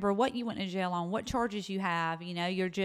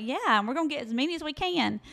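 eager reply; 7 semitones higher, faster than usual. Retold or imitated. Proud reply to jailer.

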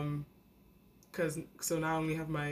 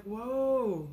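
high, amazed whoa; taken aback all at once; stunned whoa; 6 semitones higher than usual. Retold or imitated. Meeting a granddad she can't talk to.

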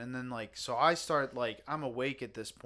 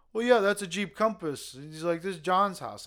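higher, louder stranger voice; confused intruder; 6 semitones higher, 6 dB louder than usual. Retold or imitated. Stranger at window insisting, higher and louder. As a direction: play the lost drunk guy insisting.

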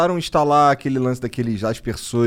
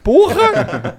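laughing exclamation; 10 semitones higher, 3 dB louder than usual. Real, not acted. Laughing outburst about drunk friend.

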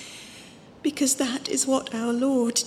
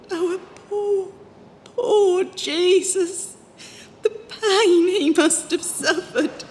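tearful; 5 semitones higher, slower than usual. Acted. Pilgrim tells Dame Julian of Christ's suffering, weeping. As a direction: voice breaking into sobs, slow and higher.

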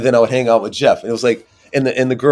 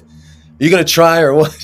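louder and higher, irritated teammate calling him out; retold annoyed challenge; 6 semitones higher, 6 dB louder than usual. Teammate getting mad at him during a pickup game, irritated and louder. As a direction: snap at him to take the game seriously. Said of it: "and he got mad".

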